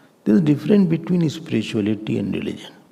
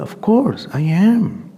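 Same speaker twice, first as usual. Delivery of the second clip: higher, emphatic offended retort; indignant; 9 semitones higher, 5 dB louder, slower than usual. Acted. Man owning up to being hurt, indignant. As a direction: play the affronted man insisting.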